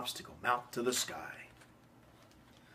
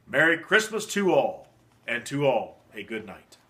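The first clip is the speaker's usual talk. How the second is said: loud, ringing goodbye call; exclaiming farewell; 7 dB louder than usual. Acted. Santa exclaims farewell while driving out of sight. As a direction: call it out as the sleigh flies off. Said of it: "I heard him exclaim as he drove out of sight".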